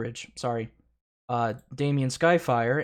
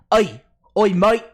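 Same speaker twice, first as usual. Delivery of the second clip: loud, high mock Cockney shout; a bad British geezer yelling; really bad British; 8 semitones higher, 9 dB louder than usual. Doing a terrible British accent at chat. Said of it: "No, that's British. Really bad British".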